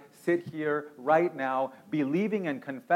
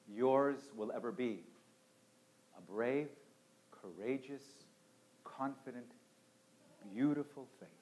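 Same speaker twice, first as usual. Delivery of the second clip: quiet closing blessing; 3 semitones lower, 13 dB quieter than usual. Acted. Soft closing benediction.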